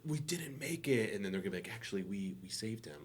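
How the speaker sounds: half-whispered grim news; quiet. Pretend. Pros report after mission.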